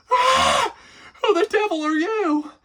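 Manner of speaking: very high, loud scream of fright. Acted. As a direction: shriek at the stranger in the kitchen. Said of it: "turned around and screamed".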